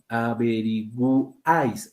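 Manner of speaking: "Averiguáis" drawn out slowly with an audible U; U sounded. Shown as a demonstration. Teacher showing the class that the U is pronounced, slow and deliberate. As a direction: sound the U in "Averiguáis", stretching the word. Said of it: "sí se está pronunciando la U".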